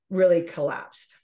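ordinary talk, nothing marked

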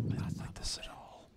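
hushed nervous whisper, barely voiced. Real, not acted. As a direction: murmur it in dread under your breath. Tense moment, whispering to self.

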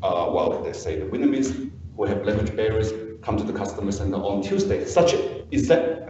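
ordinary talk, nothing marked

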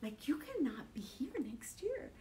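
you cannot be here next year, dropped soft and intimate; preacher quietly repeating the warning to the congregation; soft urgent repeat. Acted. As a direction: lean in and say it gently but firmly. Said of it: "So I wanna tell you the same thing".

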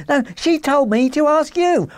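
high, insistent little man; whining protest. Acted. Little man protesting to the salesman, whining.

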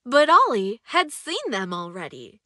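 usual speaking voice

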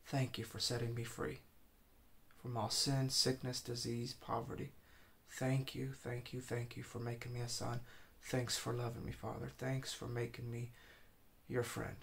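soft, hushed thanks spoken to God; quiet prayer. Shown as a demonstration. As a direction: pray quietly from the heart. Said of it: "That's how I would pray".

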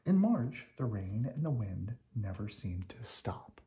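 plain reading voice

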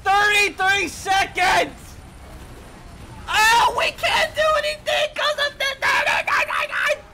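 high, slow, outraged yell; outraged shout. Real, not acted. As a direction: rage at the long timer. Respawn timer rage.

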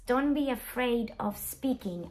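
usual speaking voice

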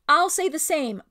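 loud, high sailor's call; hearty agreement. A narrator's reading. Sailor seconds the mouse before the crew, hearty. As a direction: pipe up boldly from the crew.

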